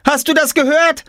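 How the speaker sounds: very high, outraged tattling; Schreien. A narrator's reading. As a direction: shriek your complaint to mum. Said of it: "schrie Jule". (empört und sehr hoch geschrien)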